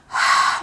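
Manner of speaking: long exhaled "hhh" of pure air; voiceless ha sound. Shown as a demonstration. Teacher demonstrates the letter ha, voiceless breath. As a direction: push a flow of air out, vocal cords still. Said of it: "just a flow of air that's going outside".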